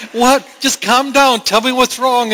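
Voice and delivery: put-on a frantic mom trying to calm her child; mother begging her sobbing toddler to talk, high; worried mom. Mom voiced to crying toddler.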